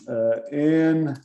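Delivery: ordinary delivery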